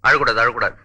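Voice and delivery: low, tender don't cry; soothing. Acted. Comforting the crying girl.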